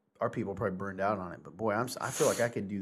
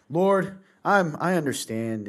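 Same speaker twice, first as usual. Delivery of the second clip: high, loud mock-pious prayer; 6 semitones higher, 8 dB louder, much slower than usual. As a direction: put on an understanding act for God.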